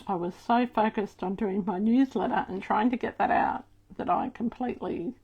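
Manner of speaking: ordinary talk, nothing marked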